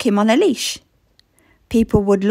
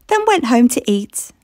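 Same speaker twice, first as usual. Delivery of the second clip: ordinary delivery, nothing marked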